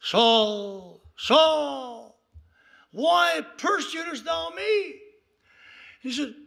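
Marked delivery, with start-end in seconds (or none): 0.0-5.0 voice from heaven. Retold or imitated. Heavenly voice confronting Saul on the road, building call. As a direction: call the name like a booming summons, then challenge. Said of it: "He hears a voice".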